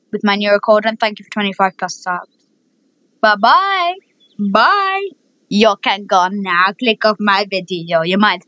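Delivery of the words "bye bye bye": cheery goodbye. Real, not acted. Cheery sign-off.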